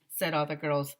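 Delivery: ordinary delivery, nothing marked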